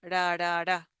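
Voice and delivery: "Ra, ra" with one light tongue tap each; flapped R. Shown as a demonstration. Teacher showing the R in amores, as a flap T. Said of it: "the R is like the flap T R".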